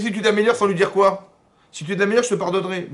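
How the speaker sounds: high, forceful incredulous repeat; loud exclamation. Acted. Teacher objects that the promise was left unexplained, loud exclamation. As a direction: raise the voice sharply in protest.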